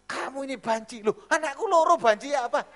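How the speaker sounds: high, loud indignant retort; dimarahin. Retold or imitated. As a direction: act out a shouted quarrel. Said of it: "Saya pernah dimarahin". (adu mulut lantang bernada tinggi)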